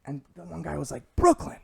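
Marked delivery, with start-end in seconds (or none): 1.2-1.6 high, loud disbelieving Brooklyn; can't believe what he heard; incredulous. Retold or imitated. One gym guy doubting the other, incredulous.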